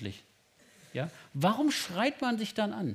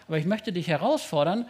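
ordinary talk

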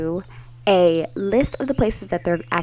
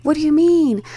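raised, incredulous mock outburst; alarmed disbelief; 8 semitones higher than usual. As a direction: demand answers, high and alarmed. Acted panic at friend's absence.

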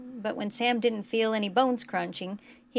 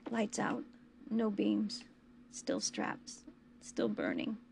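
a faint whisper that fades to pure breath; barely voiced, as if in pain; a broken whisper reliving the burning; 8 dB quieter, slower than usual. A narrator's reading. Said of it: "Bucky whispered again".

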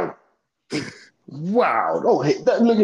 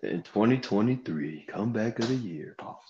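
deep announcer voice; 7 semitones lower, 11 dB quieter than usual. Pretend. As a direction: drop into a deep trailer voice. Announcing the award again.

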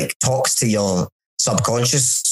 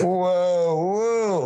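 high, drawn-out "whoa"; re-enacted wonder, floating upward; airy imitation of being astonished; mock amazement; 8 semitones higher than usual. Acting floating arm under hypnosis.

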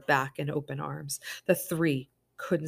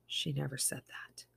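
whispered aside; 9 dB quieter than usual. A narrator's reading.

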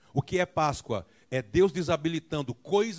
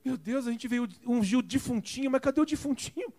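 imitating the women; 10 semitones higher than usual. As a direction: play the puzzled perfumed women. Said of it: "Elas assim, ó".

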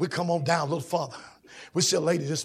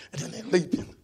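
normal talking voice

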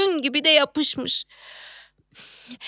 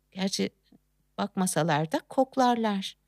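ordinary talk

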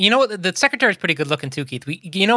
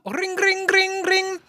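phone ringing imitation; 12 semitones higher, slower than usual. Phone call to sandwich shop.

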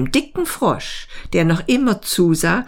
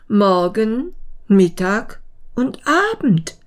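puzzled pondering; 4 semitones higher than usual. Acted. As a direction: mull over each word, higher, with pauses. Beetle ponders the words, scratching head.

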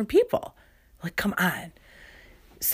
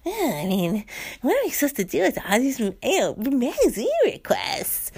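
huffy librarian; 8 semitones higher than usual. Pretend. The imagined librarian after the hang-up, high and indignant. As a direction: play offended innocence. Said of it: "in her mind, she's like".

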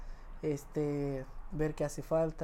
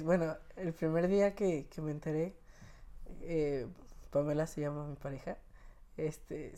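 ordinary talk, nothing marked

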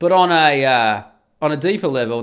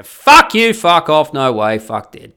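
explosive refusal; 10 dB louder, slower than usual. Acted. Host rejects an imagined government job offer, in an angry shout.

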